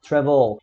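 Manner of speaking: "travel" with the stress moved to VEL; tônica na última sílaba. Shown as a demonstration. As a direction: say "travel" landing hard on VEL. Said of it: "a gente lá fala". ("travel" com tônica no VEL)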